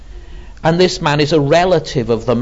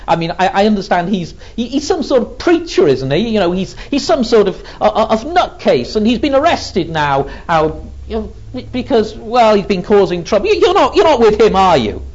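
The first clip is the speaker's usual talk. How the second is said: higher, quick, stammering busybody rattle; mocking servant girl; 6 semitones higher, 3 dB louder, faster than usual. Gate girl needling Peter about the arrested preacher, mocking. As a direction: chatter accusingly, building louder to the final question. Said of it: "in that mocking way".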